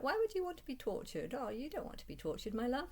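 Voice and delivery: high, sing-song mock of the man; syrupy mock voice, pitched well up; mocking his voice. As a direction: coo it like a sappy caricature. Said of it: "posted some sort of voice chat".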